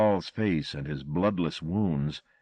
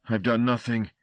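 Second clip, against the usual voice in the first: pleading; 5 semitones higher, 4 dB louder than usual. A narrator's reading. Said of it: "Luther pleaded".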